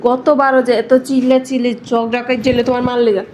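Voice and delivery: ordinary talk, nothing marked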